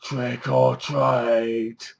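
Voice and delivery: drawn-out, very slow deep-voiced caller; imitating a deep, creepy slow trick-or-treater. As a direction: stretch each word out slowly in a put-on deep voice. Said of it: "somebody with a very deep voice going".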